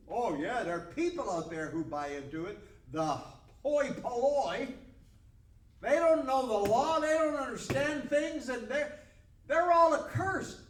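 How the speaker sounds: loud scorn climbing to a high shout; contemptuous rant. Acted. Priests dismiss the people who believe, contemptuous.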